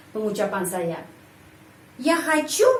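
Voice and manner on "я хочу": хочу lifted sharply to make a question; question intonation. Shown as a demonstration. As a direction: pitch jumps up on the stressed end of хочу. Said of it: "penekanan di sini, penekanan intonasi".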